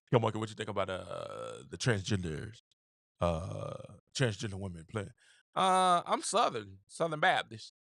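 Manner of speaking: a deliberately poor coach impression; bad Kim Mulkey impression. Podcaster mocking a coach interview, low and quiet. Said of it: "That's a horrible Kim Mulkey".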